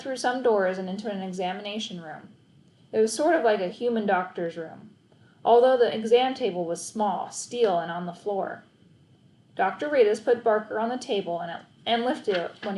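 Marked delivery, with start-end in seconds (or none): none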